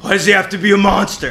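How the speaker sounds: hammed-up earnest protest; impassioned. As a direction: defend him with heat. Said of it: "And Red's like".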